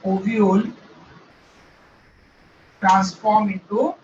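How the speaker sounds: very slow, spaced words to write down; slow dictation. Shown as a demonstration. Teacher dictating English notes, very slow. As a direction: spell out each word for note-taking. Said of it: "slow dictation of notes".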